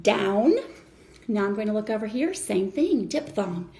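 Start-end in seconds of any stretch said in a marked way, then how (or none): 0.0-0.6 "Down" said louder and drawn out, rising; stretched, loud "Down"; syllable sounded out. Shown as a demonstration. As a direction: model "Down" with the "ow" held. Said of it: "pronounce it like a diphthong".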